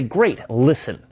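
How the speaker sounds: "Great" high and punched, "listen" falling; emphatic descending tone. Shown as a demonstration. Caller drops a command on the prospect, emphatic and descending. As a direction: hit "Great, listen" firmly, dropping in pitch. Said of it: "say it emphatically with a descending tone".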